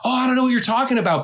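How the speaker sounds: high, quick mock denial; imitating feigned ignorance. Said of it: "she was just like".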